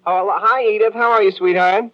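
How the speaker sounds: a husband caught out, faking delight for his wife; fake cheerful. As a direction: cover your guilt with sugary cheer. Husband covers when wife calls.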